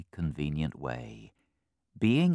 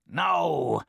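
firm fox; 10 semitones higher, 8 dB louder than usual. Acted. Fox refuses.

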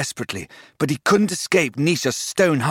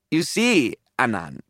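mild taunt. A narrator's reading. Snake shows off to captive Anand, mildly taunting. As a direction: taunt with a light touch. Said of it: "the snake taunted".

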